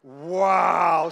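shouted wow with the crowd; loud wow. Shown as a demonstration. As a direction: belt it out together. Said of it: "Say it with me loud".

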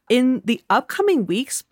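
usual speaking voice

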